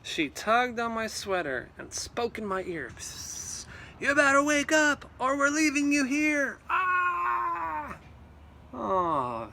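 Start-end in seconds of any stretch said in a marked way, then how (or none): 3.0-6.6 sister's urgent wake-up. Acted. Sister whispers to wake him. 6.7-8.0 startled gasp. Acted. Waking with a start.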